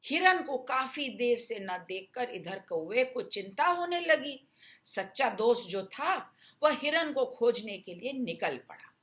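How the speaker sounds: plain reading voice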